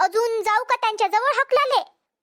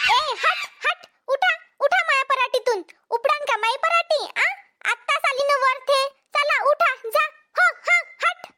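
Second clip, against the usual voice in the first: high sharp shooing calls; shooing shout; 4 semitones higher than usual. Acted. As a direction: drive the monkeys out of the crop. Shooing monkeys from the crop.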